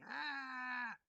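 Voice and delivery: high, drawn-out mock scream; imitated scream. Lecturer mimicking the screaming figure in the painting, mock scream. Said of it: "he's screaming".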